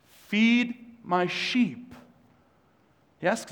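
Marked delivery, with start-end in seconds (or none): 0.1-2.0 slow tender command. Acted. Jesus answers Peter again.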